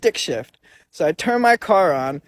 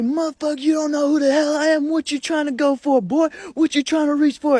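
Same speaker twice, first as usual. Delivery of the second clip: retold furious yelling; 10 semitones higher than usual. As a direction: play the armed stranger threatening him, voice up high. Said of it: "he starts screaming at me".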